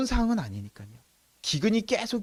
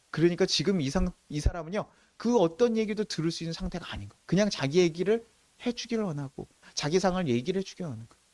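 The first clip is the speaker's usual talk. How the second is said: ordinary talk, nothing marked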